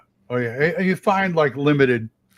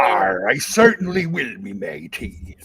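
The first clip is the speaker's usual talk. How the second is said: pirate voice; 4 semitones lower than usual. Acted. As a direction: growl it like an old sea dog. Playing Old Man Grognard.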